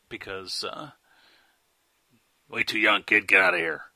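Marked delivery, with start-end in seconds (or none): 2.5-3.9 put-on gruff club bouncer shooing a kid; loud, tough doorman brushing off a kid. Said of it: "that was my bouncer voice".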